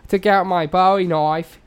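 loud, hammed-up New Zealand accent. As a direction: tell it loudly as a comic New Zealander. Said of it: "say it in a New Zealand accent".

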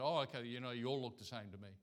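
ordinary talk

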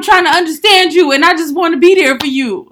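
high, loud, frustrated earnest plea. Retold or imitated. To an upset partner on the phone, loud frustrated plea. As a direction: shout that you only want to help.

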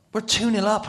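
high, loud disbelief; excited exclamation. Retold or imitated. Player who hasn't touched the ball tells of the lead, loudly excited. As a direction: exclaim the astonishing score. Amazed at being ahead.

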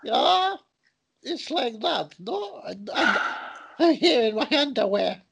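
high, jokey mock Russian accent; silly Russian accent. Comedian playing a rhino, in a hammed-up Russian accent. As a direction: ham up a cartoon Russian rhino. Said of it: "I'm doing a Russian accent".

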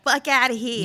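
lightly put-on Michigan accent, offhand; a quick jab in a Michigan accent; mock Michigan talk. Friend mimicking the governor's Michigan accent, dismissive. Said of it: "She like".

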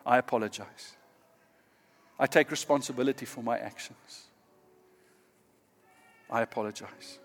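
soft, humble, sincere apology; quiet remorseful apology. Shown as a demonstration. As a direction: model genuine remorse softly. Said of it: "By saying".

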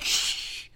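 long unvoiced shush. Family hushes Laura over the barking, drawn-out shush. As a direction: imitate a family hushing someone.

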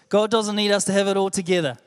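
ordinary talk

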